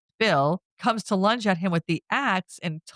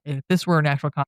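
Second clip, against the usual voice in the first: normal talking voice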